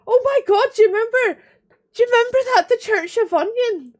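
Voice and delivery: high, loud burst of recollection; excited outburst. Real, not acted. Reminding viewers of the Church of Onion, excited. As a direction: burst out with a sudden memory. Suddenly remembers a funny episode.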